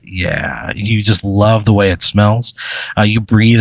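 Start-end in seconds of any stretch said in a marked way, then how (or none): 0.0-0.7 low, soft blissful "yeah"; soft, low murmur of pleasure; blissed-out reaction. Acted. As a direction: savour it softly, pitched low. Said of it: "you have that exact reaction".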